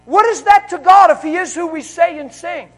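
loud, high, slow preacher roar; preaching at full force. Acted. Preacher roaring to the congregation about God's power, loud and slow. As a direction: thunder it out over the church.

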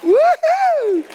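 huge, very high and loud woo-hoo; joyful whoop. Real, not acted. Pastor whooping to the congregation in pure joy, very high and loud. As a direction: let out a joyful shout of praise.

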